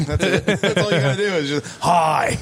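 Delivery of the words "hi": blaring one-word comedian impression; Gilbert Gottfried. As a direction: blurt it as a caricatured comic. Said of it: "I do a Gilbert Gottfried impression".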